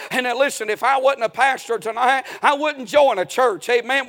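ordinary talk, nothing marked

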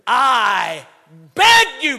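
loud preacher's plea, rising high; emphatic begging. Acted. Preacher voicing Paul begging the brethren, shouted plea. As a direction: cry it out as an urgent appeal. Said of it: "It means to literally beg".

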